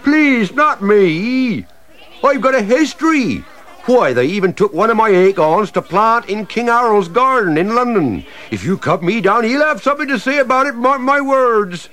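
high, loud begging; desperate plea. Acted. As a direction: plead for its life. Tree begs not cut down.

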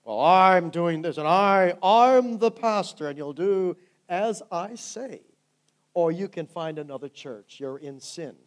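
loud, slow, self-important pastor voice; hammed-up bossy preacher; mock domineering pastor. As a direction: lord it over the congregation, loudly.

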